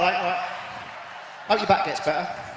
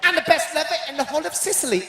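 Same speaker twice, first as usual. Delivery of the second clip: put-on Italian accent; 4 semitones higher than usual. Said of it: "No, it's Italian".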